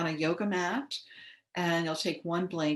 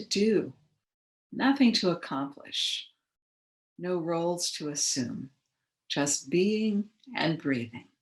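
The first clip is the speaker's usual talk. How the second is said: guided meditation. Acted. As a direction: ease listeners into relaxation, unhurried and measured. Leads guided relaxation.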